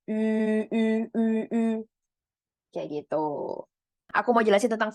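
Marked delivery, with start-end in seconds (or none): 0.1-1.6 "U" said with lips rounded as if whistling. Shown as a demonstration. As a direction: purse the lips and say "u". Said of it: "bibirnya kayak bulat".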